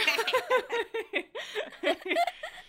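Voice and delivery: loud high cackle of laughter. Real, not acted. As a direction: crack up. Laughing at own insult.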